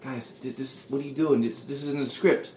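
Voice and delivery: hushed, halting aside in mock panic; imitating a furtive, under-the-breath plea; low voice. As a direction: drop the volume and stammer a discreet protest. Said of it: "starts talking in a low voice".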